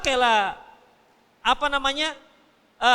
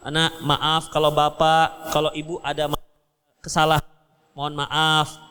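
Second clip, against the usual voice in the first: tender apology; 9 semitones lower than usual. Retold or imitated. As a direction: apologise humbly to your little one. Parent apologises to child. (orang tua minta maaf pada anak, lembut dan pelan)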